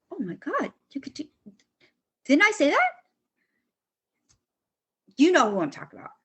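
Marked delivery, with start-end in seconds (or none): 0.1-2.9 can't believe he's repeating my own idea; exasperated disbelief. Retold or imitated. Retelling to the listener how I reacted to the officer, exasperated. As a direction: pitch leaps higher on the last words.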